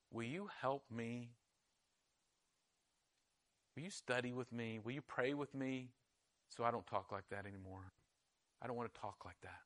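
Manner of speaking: hushed, low retold plea; humble plea. Soldier asking a friend for help, hushed humble plea.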